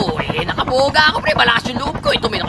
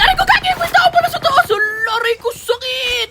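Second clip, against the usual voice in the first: pained yelp; 9 semitones higher than usual. Real, not acted. Getting shot in game.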